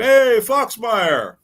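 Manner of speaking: high, loud, slow imitated greeting; drawn-out friendly call. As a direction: stretch out the name like a cheery hello. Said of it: "like that".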